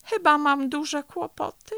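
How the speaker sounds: high-pitched, worried admission; bear's anxious realisation; dismayed bear. A narrator's reading. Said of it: "sapnął miś".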